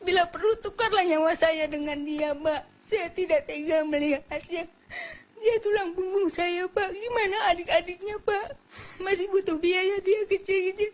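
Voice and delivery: real crying, voice climbing high; tearful pleading. Real, not acted. Mother begs the President to spare her son, crying.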